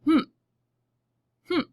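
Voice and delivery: one hummed beat, closed lips; humming the syllable. Shown as a demonstration. Teacher demonstrating to the class, humming one beat. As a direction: hum the single syllable. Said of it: "Let's hum it out".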